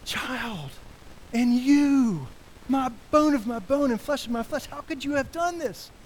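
high, anguished reproach; pained plea to a child. Pretend. Parent reproaching their own child, anguished. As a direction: plead with your own child, heartbroken. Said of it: "to get to your son or your daughter".

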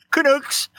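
"Canucks" with a long oo; mispronounced Canucks. Real, not acted. Podcast guest teasing Canadians, "Canucks" rhyming with kooks. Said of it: "pronounce it wrong".

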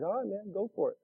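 very soft, easygoing retold reply; quiet go-ahead. Surfer friend agreeing to help him, quiet go-ahead. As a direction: imitate a friend quietly giving in.

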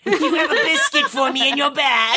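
high laughing spooky voice; squeaky creepy creature asking sweetly; jack o lantern voice. Acted. Voicing Miko, laughing.

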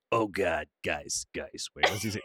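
put-on low, thick-accented brother voice; brother impression. Brother calling Paula to the guys, deep thick-accented impression. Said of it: "do a solid impersonation of your brother".

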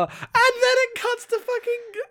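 high, loud comic outrage; exasperated, mad. Real, not acted. Host fuming at the comic's page turn, exasperated. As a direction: rant in disbelief at the book. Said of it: "I'm so mad".

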